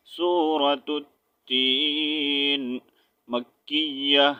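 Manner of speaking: slow melodic tilawat; Quran recitation. Acted. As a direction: intone each phrase slowly and prayerfully. Recites taawwuz and basmala. (ترتیل کے ساتھ تلاوت)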